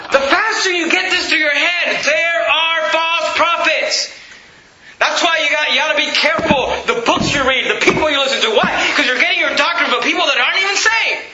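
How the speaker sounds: high, shouted pulpit warning; forceful preaching. Real, not acted. Preacher warning the church about false prophets, forceful preaching. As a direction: drive it into their heads.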